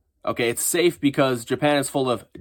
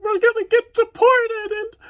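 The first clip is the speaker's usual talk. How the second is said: high, whiny mock panic; mock crying voice; 4 dB louder than usual. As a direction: squeal it like a hammed-up crybaby. Mocking panicked foreigners.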